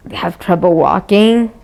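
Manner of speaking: ordinary talk, nothing marked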